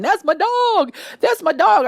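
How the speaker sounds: high-pitched retold proud yell; imitated screaming. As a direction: imitate an excited owner's yell. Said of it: "He's screaming".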